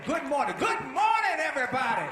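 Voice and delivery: high, very loud, exuberant shout; booming greeting. Retold or imitated. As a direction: holler it out to the whole crowd. Demonstrates his loud greeting.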